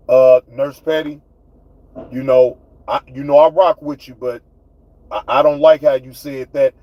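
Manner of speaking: a low, calm, sincere word on the side; low and even, demonstrating a respectful pull-aside; calm confrontation demo. Shown as a demonstration. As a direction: show how to call someone out gently, voice dropped low.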